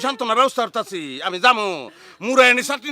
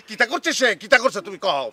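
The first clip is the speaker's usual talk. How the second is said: ordinary delivery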